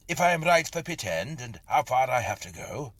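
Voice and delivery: calling out. A narrator's reading. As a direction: call out across the path. Narrator calls out to stranger.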